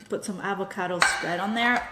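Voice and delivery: ordinary talk, nothing marked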